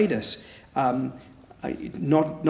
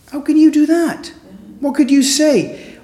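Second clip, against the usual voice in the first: high, loud astonished mother; amazed mum; 12 semitones higher, 6 dB louder than usual. Retold or imitated. A mother, astonished at her little girl, high and loud. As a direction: let the mum's amazement ring out high and loud.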